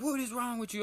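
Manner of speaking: imitated furious teacher, very high-pitched; going crazy. Teacher blows up at clumsy student, retold outrage. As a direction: shoot the pitch up in exasperated mock outrage. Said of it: "about to fucking burst a blood vessel".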